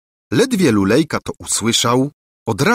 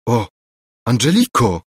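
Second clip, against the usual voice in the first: ordinary delivery, nothing marked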